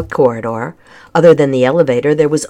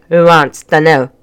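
gruff, louder Scottish-style challenge; suspicious grumble with a thick brogue; thick accent; 5 dB louder than usual. A narrator's reading. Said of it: "his accent was thick and tricky to understand".

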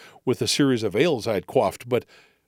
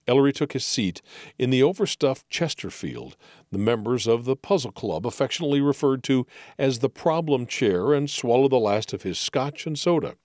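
usual speaking voice

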